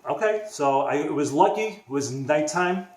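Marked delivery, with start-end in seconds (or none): 0.1-0.5 incredulous okay. Retold or imitated. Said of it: "I'm like".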